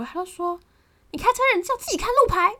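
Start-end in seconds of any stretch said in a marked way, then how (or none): 1.1-2.5 high, loud, fast harsh scolding. Retold or imitated. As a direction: snap it back at her impatiently. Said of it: "然后他就骂我".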